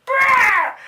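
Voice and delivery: loud, high imitated chicken screech; piercing mock bird cry; chicken squawk. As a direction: squawk suddenly like a startled hen. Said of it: "like, going, like".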